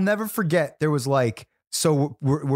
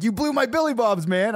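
a hint of a higher, whining brother; mock-complain in a higher voice; whiny brother; 9 semitones higher than usual. Lightly voiced brother whining about the lost bar.

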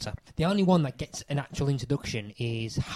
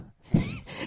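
sudden high squealing laugh; high squeal of laughter. Real, not acted. Laughing at his own bad accent, high squeal. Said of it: "That's probably the best accent I'll ever do".

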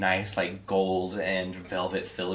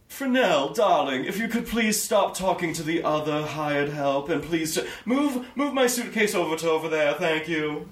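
haughty lady; 9 semitones higher than usual. Acted. Wealthy lady calling her servant Fresnel, high and imperious. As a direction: order the help around like a rich diva. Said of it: "as she just goes".

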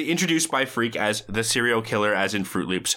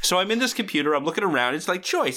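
higher-pitched, eager anecdote voice; eager storyteller; 8 semitones higher than usual. Retold or imitated. Joey desperately trying to impress the guys, eager and high. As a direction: act out someone keen to impress. Acting Joey's anecdote, second attempt.

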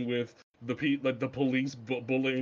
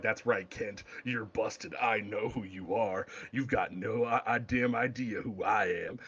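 a hint of a low, quieter Bruce gloating; understated mock Batman, lower and softer; lightly voiced smug Batman; 6 semitones lower, 4 dB quieter than usual. Bruce quoted confronting Clark.

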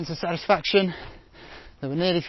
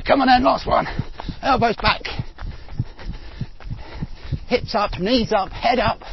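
high, loud all-out yelling; strained shouting; 9 semitones higher, 4 dB louder than usual. Real, not acted. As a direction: scream yourself through the last rep. Last rep, urging himself on.

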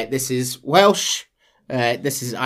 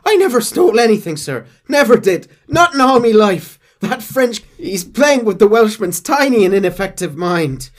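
high, loud protesting Irish brogue; Irish accent; 9 semitones higher, 8 dB louder than usual. Retold or imitated. Irishman denying theft to John, Irish accent. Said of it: "Irish stole our gun".

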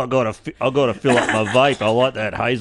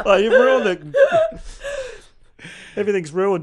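mock despair; 9 semitones higher, 3 dB louder than usual.

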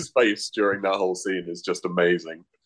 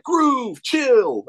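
much higher, squeaky film-character voice; squeaky film quote; 3 dB louder than usual. Retold or imitated. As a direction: pitch it way up like a cartoon hype man. Retelling a film character's line.

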